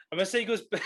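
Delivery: breaking into laughter; laughing. Real, not acted. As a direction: crack up mid-sentence. Breaks off laughing, retakes line.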